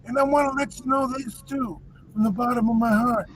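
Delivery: lightly voiced Italian mobster accent, hushed; half-joking wise-guy Italian accent; mock Italian gangster. Host teasing co-host about Italian cooking in mock Italian gangster accent. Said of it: "Yeah, jokes".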